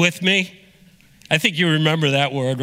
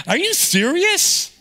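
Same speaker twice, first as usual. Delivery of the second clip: mock disbelief; 12 semitones higher than usual. Mock reaction to the word.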